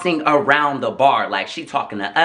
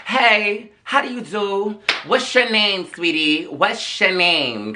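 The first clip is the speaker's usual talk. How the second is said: mock sweet; 5 semitones higher, much slower than usual. Mock-sweet greeting to the stranger.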